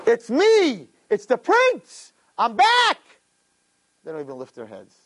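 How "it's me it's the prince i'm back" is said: high-pitched, ringing cries in short bursts; screaming. Retold or imitated. Prince screams to his broken parents, high shout. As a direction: cry out to be recognized, voice way up. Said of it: "he screams to his parents".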